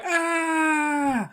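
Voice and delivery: high shrieking scream of fright; terrified scream. Acted. Kids screaming as the ghost jumps at them, high scream.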